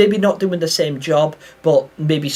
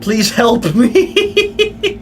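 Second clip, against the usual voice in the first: mock plea; 8 semitones higher, 3 dB louder, much slower than usual. Pleading to chat in game.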